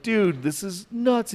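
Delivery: retold disbelief from a mildly freaked friend. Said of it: "he's, like, freaked out".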